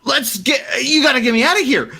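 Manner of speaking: high, fast, urgent pleading; a survivor begging the posse to leave; panicked survivor. Acted. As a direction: rush the words, desperate to flee. NPC begs to leave.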